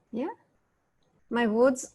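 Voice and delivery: ordinary talk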